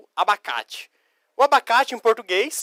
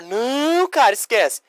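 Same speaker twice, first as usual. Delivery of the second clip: mock exasperation; 4 semitones higher than usual. Teacher answers the imagined pupil.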